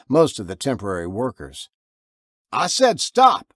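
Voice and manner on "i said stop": high, loud, slow shout of stop; shouted command. A narrator's reading. Man with a shotgun shouting at a stranger, loud slow shout. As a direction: bark it out as a warning. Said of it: "shouted Martin".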